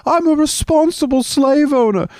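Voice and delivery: hammed-up angry slave owner yell. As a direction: shriek it like an outraged slave owner. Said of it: "your population went".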